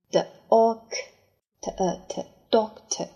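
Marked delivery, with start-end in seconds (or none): none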